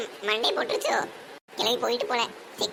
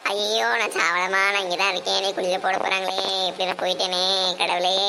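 wailing lament; 3 semitones lower than usual. Acted. Old woman about to be buried, wailing lament. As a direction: draw out each phrase in despair.

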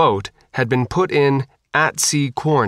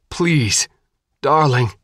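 higher, pleading with a loved one; 4 semitones higher, slower than usual. A narrator's reading. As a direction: beg your darling. Begging her to come with him.

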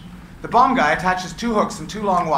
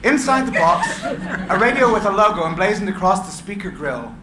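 laughing; 2 semitones higher, 6 dB louder than usual. Real, not acted. Narrator still laughing as he reads the reveal. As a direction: laugh through the words. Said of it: "laugh heard".

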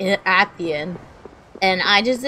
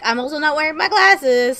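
ordinary talk

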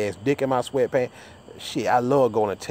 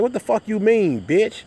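mock angry comeback; 8 semitones higher than usual. [name]'s retold angry reply.

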